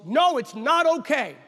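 loud, slow, high refusal; firm objection. Retold or imitated. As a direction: imitate an emphatic protest. Son's indignant retold objection.

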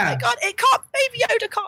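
high, distraught squeal; hammed-up panicked protest; reenacted screaming. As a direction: shriek in mock horror for a beloved creature. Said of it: "I was screaming the entire time".

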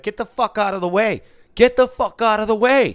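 very high, very loud furious shriek; screaming. Retold or imitated. Angry woman screams at man blocking the subway door. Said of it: "she was like".

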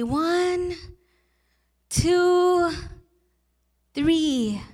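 each number stretched long, with pauses between; soothing drawn-out count. Retold or imitated. Aunt counting to three for her hurt nephew, drawn-out soothing count. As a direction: count slowly and sustained, as for a small child.

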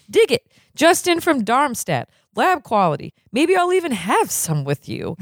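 giddy salesman pitch; high excited doctor. Acted. Blatnoyd shows cocaine.

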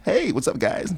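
high, perky greeting to tables. Shown as a demonstration. Server greeting tables as the shift starts, high-pitched and put-on friendly. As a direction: voice jumps up for a customer hello. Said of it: "The pitch in my voice goes up".

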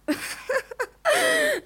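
high mock laugh; scornful laugh. A narrator's reading. Mother ridiculing her daughter's request, scornful laugh.

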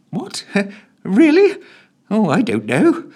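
very high, helpless secretary; flustered secretary. Acted. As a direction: at a loss, pleading.

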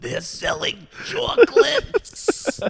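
high squeaky slow cartoon voice; SpongeBob impression. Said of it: "one of the best episodes".

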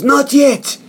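high-pitched mock defiant retort; acted defiance. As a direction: refuse to quit, voice jumping up. Acting out Shingo refusing to stop.

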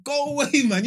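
high, loud retold protest; alarmed brush-off. Storyteller to grabbing women on seeing bag open, alarmed. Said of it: "When I see my joints open".

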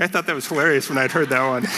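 quick words breaking into laughter; amused at your own joke, laugh through it. Real, not acted.

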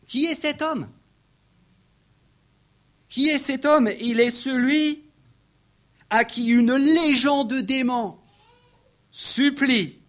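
high, ringing, emphatic proclamation; impassioned preaching. Real, not acted. Preacher proclaiming who this man is, at full force. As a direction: build to a loud declaration, pausing between phrases.

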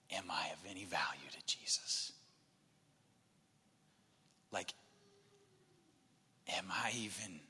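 unvoiced, hushed whisper of self-doubt. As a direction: whisper it as if afraid to ask aloud. Peter's despairing inner voice, retold.

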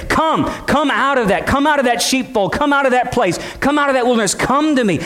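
shepherd calling. Acted. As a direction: call them out of the wilderness. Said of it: "He would be ahead of them and say".

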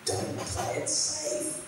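whispered, high and slow; breathy whisper. Retold or imitated. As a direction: breathe the challenge out softly. Quoting a challenge to the church.

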